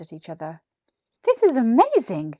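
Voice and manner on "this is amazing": put-on louder, thrilled kid voice; amazed boy. Billy amazed at the stone turned to stick, excited. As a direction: burst out in wonder.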